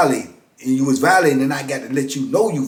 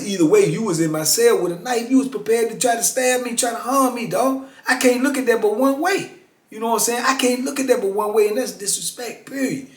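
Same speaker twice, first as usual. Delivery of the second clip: heated retort; 8 semitones higher than usual. Retold or imitated.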